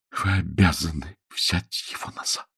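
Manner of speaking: soft, subdued insistence; quiet voice. A narrator's reading. Woman returns fiancé's gift, in a quiet voice. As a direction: drop the volume and insist gently. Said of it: "проговорила она тихим голосом".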